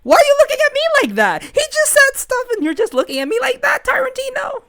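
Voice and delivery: very high, frantic squealing rant; screaming. Acted. Leo demands why Tarantino stares, shrieking. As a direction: shriek it all at the top of your range. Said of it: "Stop with that screaming".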